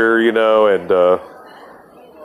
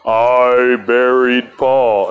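very slow, drawn-out words; mock eerie, drawn-out record voice; imitating the record's end; 2 semitones higher, much slower than usual. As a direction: stretch each word out like a slowed record. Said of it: "it comes to the very end. It goes".

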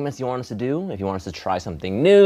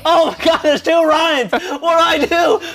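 high mock panic; 7 dB louder than usual. S1 keeps up Ryan bit.

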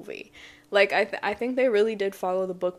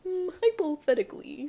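lightly voiced know-it-all; Stink's voice; 8 semitones higher than usual. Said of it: "I think of Stink as more like".

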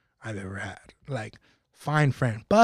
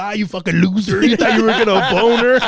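loud, laughing taunt, higher pitch; mocking friends; 5 semitones higher, 9 dB louder than usual. Imagined homies roasting him for the boner, loud laughing jeer. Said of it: "my homies were gonna be like".

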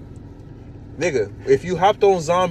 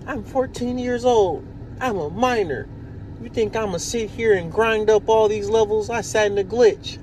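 put-on whiny kid voice; 8 semitones higher than usual. Gamer quotes the fourteen-year-old kid, high and whiny. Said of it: "he was like".

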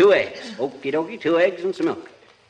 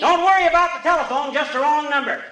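raised voice across rooms; husband calling to wife from kitchen, raised voice; 9 semitones higher than usual. Acted. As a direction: shout the question to the bedroom. Calling to Molly in bedroom.

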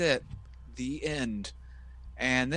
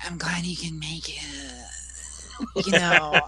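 mock seductive voice; 4 dB louder, much slower than usual. Dawn Marie seducing Tori.

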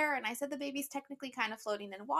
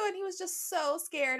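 usual speaking voice

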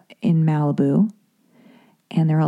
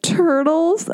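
high, drawn-out incredulous mimicry; mocking musician; 10 semitones higher than usual. Musician making fun of her love of turtles, mocking. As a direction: imitate her ridicule, pitched way up. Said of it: "he, like, laughed with her".

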